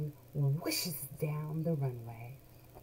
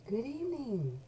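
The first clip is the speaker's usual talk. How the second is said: put-on voice; 5 semitones higher than usual. Shown as a demonstration. Greeting variations demo.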